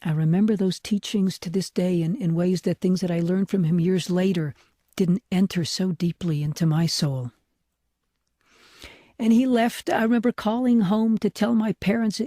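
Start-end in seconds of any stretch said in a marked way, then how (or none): none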